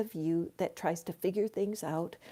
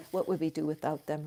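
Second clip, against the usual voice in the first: usual speaking voice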